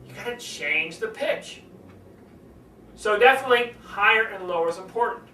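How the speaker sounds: ordinary delivery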